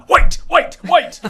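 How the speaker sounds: mock Scottish accent. Mocking Humza's accent.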